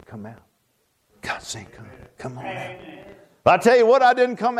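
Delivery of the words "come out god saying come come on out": softer, hushed calling voice; God's call. Retold or imitated. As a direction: murmur the call as if heard inside.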